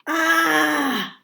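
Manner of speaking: high, frustrated scream; exasperated "aah" yelled out; angry scream. A narrator's reading. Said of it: "I let out an angry scream".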